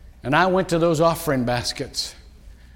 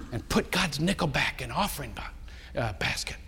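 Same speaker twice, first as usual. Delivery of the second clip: hushed whisper, much softer and lower; 4 semitones lower, 9 dB quieter than usual. Retold or imitated. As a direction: breathe it as a guilty secret. Confessing a secret plan.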